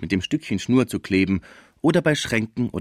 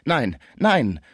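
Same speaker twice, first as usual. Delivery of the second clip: mildly horrified, raised refusal; entsetzt; 6 semitones higher, 3 dB louder than usual. A narrator's reading. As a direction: cry out in dismay, contained. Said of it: "rief Schmucke entsetzt". (leicht entsetzter Ausruf)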